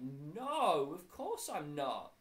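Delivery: loud, slow outburst of refusal; emphatic denial. A narrator's reading. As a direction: blurt out an exasperated no. Dennis admits he isn't ready.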